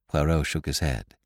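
ordinary delivery

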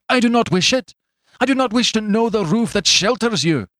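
very high, forceful outburst; vehement interruption. A narrator's reading. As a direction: cut in with furious refusal. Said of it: "interrupted the other".

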